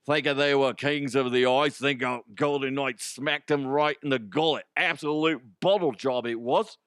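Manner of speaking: punchy, put-on Cockney; Cockney accent. Host trying an accent for a scripted hockey headline, mock Cockney. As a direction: read it out in a lively mock Cockney. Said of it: "It's supposed to be Cockney. Cockney".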